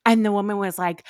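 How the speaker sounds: ordinary talk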